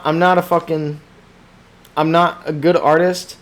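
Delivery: normal talking voice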